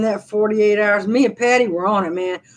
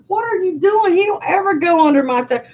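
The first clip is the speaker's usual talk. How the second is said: puzzled high voice to dog; 10 semitones higher than usual. Retold or imitated. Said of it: "I'm like".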